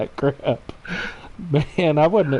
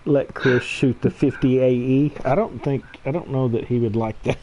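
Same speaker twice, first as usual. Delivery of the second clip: ordinary delivery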